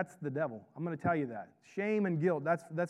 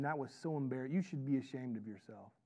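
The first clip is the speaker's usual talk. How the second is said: much softer, lower shaming; hushed mock scolding; 4 semitones lower, 9 dB quieter than usual. God as wrongly imagined, shaming us for mistakes, hushed scolding. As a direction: scold under the voice, low and subdued.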